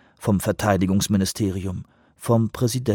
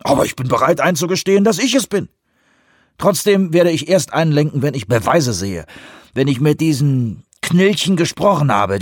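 heated, high-pitched and louder tirade; scornful, insistent sceptic; Vanderbilt, combative; 6 semitones higher, 3 dB louder than usual. Acted. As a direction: argue stubbornly, refusing to give in.

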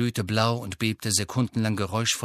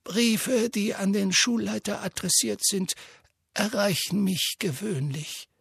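put-on calm, measured old wizard; Dumbledore voice; 9 semitones higher than usual. A narrator's reading.

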